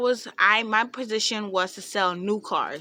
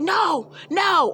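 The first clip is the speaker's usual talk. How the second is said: high, raised-voice scolding; mad trainer; 8 semitones higher, 4 dB louder than usual. Retold or imitated. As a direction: imitate a trainer snapping in exasperation. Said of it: "she would get really mad".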